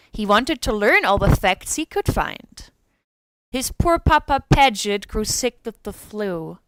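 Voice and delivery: ordinary talk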